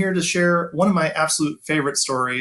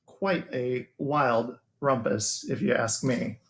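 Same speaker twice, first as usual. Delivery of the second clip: gentle storybook narration with long pauses; reading to children; 4 semitones higher than usual. A narrator's reading. Teacher reading a bedtime picture book to his students, storytelling voice.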